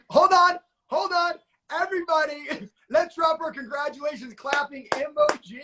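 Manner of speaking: high, hyped-up announcing; excited celebration. Real, not acted. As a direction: stop the room to cheer someone's win. Host halts everyone to celebrate.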